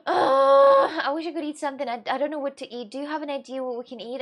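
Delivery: mock helpless hunger; whiny hungry friend. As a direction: beg a friend for food ideas. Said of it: "I'm like".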